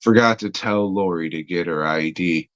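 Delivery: ordinary delivery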